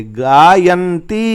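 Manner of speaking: slow demonstration of गायंती; slow blended word. Shown as a demonstration. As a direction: say गायंती slowly, joining न त and ई. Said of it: "ई को जोड़ेंगे".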